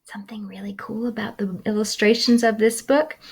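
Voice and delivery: fast whispered aside; fast hushed aside. Real, not acted. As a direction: lean in and whisper it quickly. Aside about illustrations.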